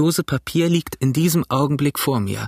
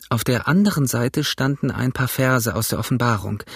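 ordinary delivery, nothing marked

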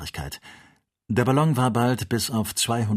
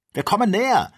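high, loud cry of warning; urgent, high-pitched yell; alarmed shout; 13 semitones higher, 8 dB louder than usual. A narrator's reading. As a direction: shout it out as danger closes in. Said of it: "schrie Joe".

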